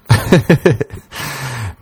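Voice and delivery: a breathy high laugh at his own joke. Real, not acted. Comedian laughing at his own erection joke, high laugh.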